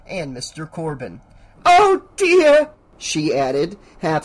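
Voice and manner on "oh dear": loud, high-pitched mock distress; hammy shock. A narrator's reading. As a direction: cry out for an audience's attention. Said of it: "interrupted him with a gasp loud enough".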